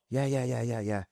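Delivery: quiet, offhand yeahs from mum; dismissive. Retold or imitated. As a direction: brush the kid off with soft yeahs.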